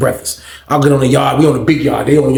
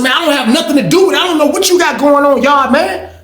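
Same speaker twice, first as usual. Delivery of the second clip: heated; 11 semitones higher than usual. Retold or imitated. Heated argument with the guy.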